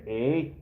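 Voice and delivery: "eh?" as a rising question tag; Canadian "eh". Shown as a demonstration. Streamer teaching viewers the big thing, "eh?" said as a rising tag. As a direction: show how to say "eh?", lifting at the end. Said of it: "You gotta learn how to say".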